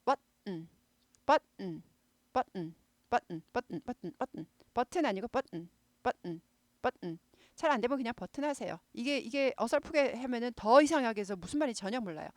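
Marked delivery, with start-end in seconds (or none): 0.0-7.2 버튼 as but, a stop, then a barked n; 버튼 with a clipped t and throaty n; staccato button. Shown as a demonstration. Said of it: "but 하고 스타카토 하고 은 하는 거".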